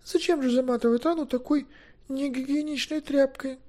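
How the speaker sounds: put-on very high girlish voice; girl's earnest objection. Girl objects.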